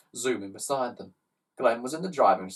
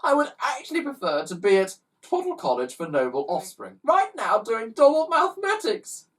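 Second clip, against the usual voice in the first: put-on upset child; 10 semitones higher, 5 dB louder than usual.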